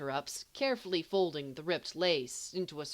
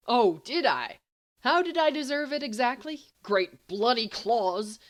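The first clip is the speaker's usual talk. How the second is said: high, loud incredulous outrage; louder mock-outraged retort; indignant; 7 semitones higher, 8 dB louder than usual. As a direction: throw the question back, bristling. Indignant comeback in a quarrel.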